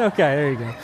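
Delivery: usual speaking voice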